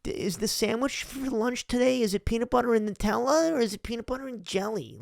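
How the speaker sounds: put-on high, timid, polite child; polite kid voice. Boy calling his mom about lunch, meek and polite. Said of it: "They're going".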